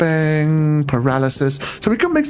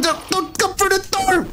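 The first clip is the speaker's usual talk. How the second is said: high startled cry at the door; scared horror game streamer; 14 semitones higher than usual. Real, not acted. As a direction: jump scare yelp, very high. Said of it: "That jump scared me".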